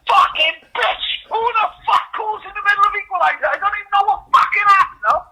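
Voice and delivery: high furious rant; angry yell. Retold or imitated. Imitating angry father yelling.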